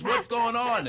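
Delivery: a hint of a panicked new ghost; upset Will. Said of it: "he was not happy about being dead".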